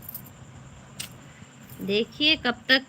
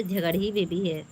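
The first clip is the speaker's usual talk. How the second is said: ordinary talk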